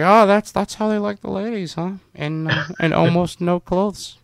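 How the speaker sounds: high-pitched put-on voice; a comic, high impersonation of the film director; George Lucas. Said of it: "That's my George Lucas impersonation".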